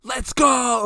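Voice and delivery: loud, high burst of excitement; excited cheer. Real, not acted. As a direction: shout it out, pumped. Excited cheer for favorite film.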